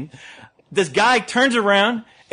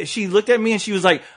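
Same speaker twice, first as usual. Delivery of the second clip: ordinary delivery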